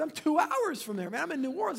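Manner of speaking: high, excited retold disbelief; amazed. Young man telling the man on the phone he lives close, amazed. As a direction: imitate amazement at the coincidence.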